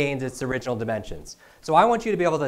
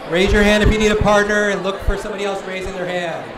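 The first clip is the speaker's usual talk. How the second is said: high, raised voice over chatter; calling out; 7 semitones higher, 3 dB louder than usual. Real, not acted. Speaker helping audience members pair up, calling out. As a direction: project it across the room.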